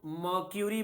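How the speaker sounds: मर्क्यूरी as "maw-kyu-ree", no R before K; MERCURY with "kyu". Shown as a demonstration. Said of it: "आर silent होता है".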